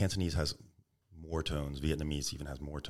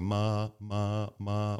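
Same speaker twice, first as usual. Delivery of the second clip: "ma" repeated on one level pitch; all first tone; 3 semitones higher, much slower than usual. Retold or imitated. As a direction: drone each "ma" identically flat. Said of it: "all first tone".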